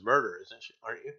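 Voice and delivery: usual speaking voice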